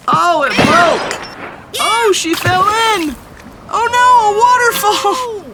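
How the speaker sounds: high, loud rising alarm; alarmed cries. Acted. Narrator watches cat fall, high loud alarm. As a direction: shout as she falls. Bridge breaks, cat falls.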